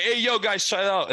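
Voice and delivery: high-pitched plea to calm down; urgent peacemaking. Retold or imitated. Friend to bouncers shoving the speaker, high urgent appeal.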